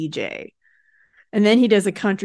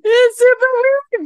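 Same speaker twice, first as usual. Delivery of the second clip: excited exclamation; 7 dB louder than usual. Real, not acted.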